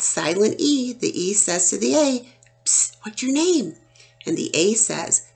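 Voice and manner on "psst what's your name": breathy unvoiced whisper, a bit higher; playful stage whisper to a letter. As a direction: lean in and whisper the secret question. E whispers to the vowel.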